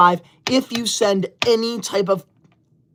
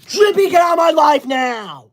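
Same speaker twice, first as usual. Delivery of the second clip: higher, loud hammed-up rant; mock furious shout; 7 semitones higher, 4 dB louder than usual. Streamer shouting at chat over the hat, mock furious. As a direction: shout the troll out of the live. Banning Drippy again.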